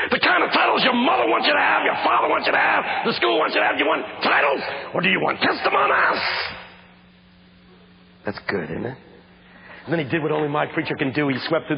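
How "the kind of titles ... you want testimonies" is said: high, loud and fast preacher's cry; pastor preaching. Retold or imitated. Pastor challenging students, high shouted preaching. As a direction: hammer the question home like the pastor. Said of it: "he says".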